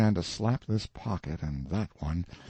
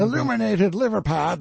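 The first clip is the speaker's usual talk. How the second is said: higher, louder derisive jeer; mocking shout; 12 semitones higher, 8 dB louder than usual. A narrator's reading. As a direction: jeer at the painting. Collector mocks the painting.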